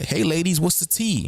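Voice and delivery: put-on high, chirpy 'spill the tea' girlfriend; playful mock gossip girl asking the ladies; gossipy girlfriend voice. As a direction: ask for the gossip like a nosy friend. Mocking gossip-hungry ladies.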